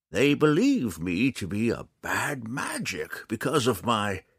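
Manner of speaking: high, slow character voice; old man, halting. Acted. Old man explains himself to the drow, slow and high.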